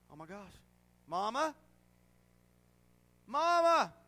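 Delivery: put-on lost child crying out for Mama, rising to a loud high call; panicked child calling. Little girl lost in Walmart calling her mother, panicked. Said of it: "She's yelling, she's hollering".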